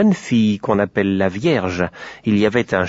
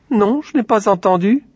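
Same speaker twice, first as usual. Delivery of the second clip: petit cochon; 10 semitones higher than usual. Acted. Le cochon répond.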